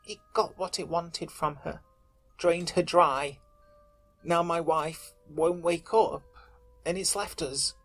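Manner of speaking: soft, slow, pleading whimper. Acted. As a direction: beg them, voice breaking. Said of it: "his voice whimpered".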